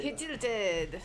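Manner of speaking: mock French accent. Friend echoing the tilted player, mock French accent. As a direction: echo it in a fake French accent. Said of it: "say it in a French accent".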